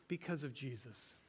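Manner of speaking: soft and low, because of Jesus; humble answer at heaven's gate, hushed. Pretend. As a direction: say it gently, almost under breath. Right answer quoted softly.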